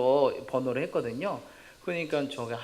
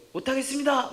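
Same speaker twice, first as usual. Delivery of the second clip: high, raised mock protest; pretend refusal; 11 semitones higher, 5 dB louder than usual. Student imagines refusing the teacher, mock protest. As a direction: cry out a refusal in play.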